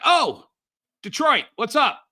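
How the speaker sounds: high, loud delighted hello; excited greeting. Retold or imitated. Capullo spotting him in the airport, loud and high delighted greeting. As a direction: call out like spotting an old friend.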